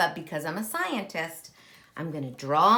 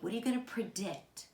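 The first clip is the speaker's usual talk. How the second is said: usual speaking voice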